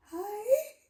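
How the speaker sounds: very high, squeaky acted greeting; starstruck fangirl. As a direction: squeak it like an overwhelmed fan. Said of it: "those fangirl interactions, like, that's how I felt".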